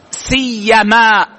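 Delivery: سيما alone, slow and drawn out; pronunciation demo. Shown as a demonstration. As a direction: model سيما slowly for the class. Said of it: "ويجوز حذف الواو "ولا". (نطق سيما وحدها ببطء)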